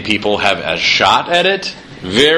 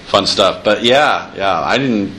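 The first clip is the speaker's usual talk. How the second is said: ordinary talk, nothing marked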